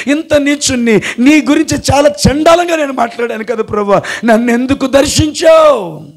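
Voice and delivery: high, pleading retold lament; imploring cry, rising to a shout at the end; father's tearful prayer. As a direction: voice a weeping father's confession to God. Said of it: "మా నాన్న ఏడ్చాడు".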